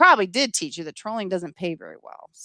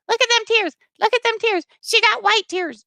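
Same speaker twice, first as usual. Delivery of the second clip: high-pitched jeering voice; put-on sing-out mockery, pitched way up; mocking taunt; 12 semitones higher than usual. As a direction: jeer like a heckler pointing out tears. Mimicking taunts about her tears.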